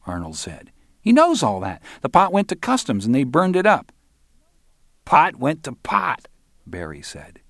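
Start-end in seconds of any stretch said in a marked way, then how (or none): 1.0-3.9 high, loud, fed-up voice; exasperated young guy. Acted. Young dealer tells of the burned pot, high exasperated voice. 5.0-6.3 high, slow, drawn-out quip; wisecracking punk. Acted. Barry jokes about the loss, high slow wisecrack. Barry makes a mocking pun.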